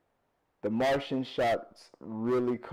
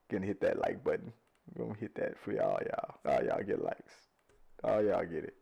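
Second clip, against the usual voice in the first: quiet aside; 5 semitones lower, 6 dB quieter than usual. Real, not acted. Aside while liking the comments.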